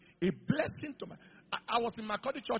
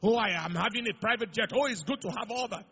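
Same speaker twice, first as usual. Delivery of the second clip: ordinary talk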